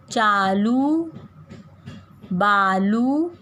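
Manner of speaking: हर शब्द धीरे, लंबे ऊ के साथ; कक्षा के साथ शब्द धीरे-धीरे दोहराना; slow drawn-out oo. Shown as a demonstration. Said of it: "आप सभी को मेरे साथ शब्द पढ़ने हैं". (बच्चों के साथ धीमा, खिंचा पाठ)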